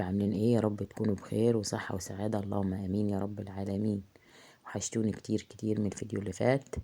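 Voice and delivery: ordinary delivery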